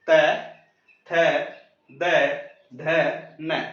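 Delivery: त, थ, द, ध, न with tongue on the teeth; relaxed dental sounds. Shown as a demonstration. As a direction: say त, थ, द, ध, न easily, one by one. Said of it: "आसानी से रिलैक्स होके बोल सकते हो". (त, थ, द, ध, न दाँतों से, आराम से)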